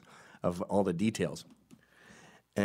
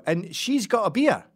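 higher, louder Scottish voice, slow; Scottish tourist; 11 semitones higher, 7 dB louder than usual. Pretend. To the margarita guys, pointing out the woman, Scottish accent. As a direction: keep the Scottish put-on. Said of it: "And I go".

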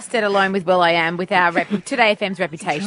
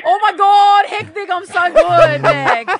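loud, high over-excited greeting; excited squealing; 11 semitones higher, 6 dB louder, slower than usual. Acted. Host echoing an excited competition caller, gushing squeal. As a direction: gush back at top pitch, drawing it out.

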